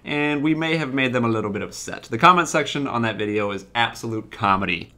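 ordinary talk, nothing marked

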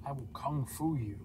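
low pretend fighter voice; deep put-on character voice; Goku. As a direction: drop down low for a mock kung fu threat. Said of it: "Goku, you're not gonna kung fu him".

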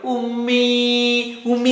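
long drawn-out bleating call for mother; crying goat. Shown as a demonstration. Teacher showing children how the goat cries, drawn-out bleat. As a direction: stretch it slowly like a lost kid goat calling. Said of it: "ഉമ്മീ എന്ന് പറഞ്ഞ് കരയാ".

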